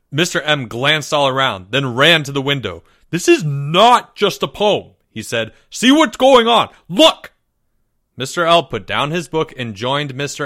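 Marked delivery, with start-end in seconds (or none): 3.1-4.9 high, loud protest; agitated insistence. A narrator's reading. Mister M at the window to Mister L, agitated insistence. 5.7-7.3 high, loud alarm; urgent shout. A narrator's reading. Mister M calling Mister L to look, urgent shouting. Agitated teacher points out window.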